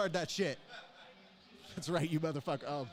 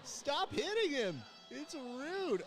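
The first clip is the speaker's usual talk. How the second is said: high mock pleading; much slower than usual. Begging fighters to stop hitting.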